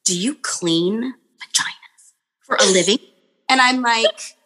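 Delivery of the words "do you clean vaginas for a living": hushed, breathy imitation of a whispering child; mock stage whisper; imitated whisper. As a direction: whisper it like a kid asking something secret. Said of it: "he's, like, whispering".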